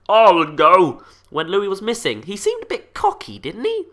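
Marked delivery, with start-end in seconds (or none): 0.1-1.0 loud, mock-bold proclamation; president's declaration. President offering to go when Louie was missing, loud and cocky. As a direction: boom it out as a cocky boss. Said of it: "the president says".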